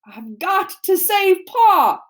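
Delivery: high, loud, drawn-out cry; slow, straining shout of determination; desperate insistence. A narrator's reading. Bear insists on rescuing father.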